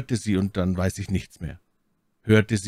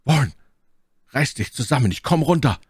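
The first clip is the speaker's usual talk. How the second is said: raised, urgent call to a friend; 6 semitones higher, 4 dB louder than usual. A narrator's reading. Narrator to Warren down below, urgent calling out. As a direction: call down firmly, pitch lifted. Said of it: "rief ihm zu".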